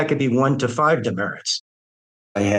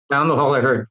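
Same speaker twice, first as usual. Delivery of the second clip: normal talking voice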